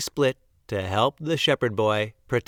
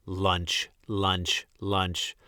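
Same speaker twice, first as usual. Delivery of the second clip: wolves; 5 semitones lower, 6 dB quieter, much slower than usual. A narrator's reading. Wolves muttering heard.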